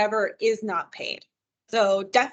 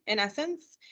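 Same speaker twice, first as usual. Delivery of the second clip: normal talking voice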